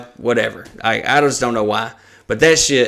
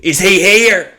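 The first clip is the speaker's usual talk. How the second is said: higher, louder mock-excited customer voice; excited imitation; 7 semitones higher, 5 dB louder than usual. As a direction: imitate a lady loudly recognizing someone. Excited lady recognizing an order.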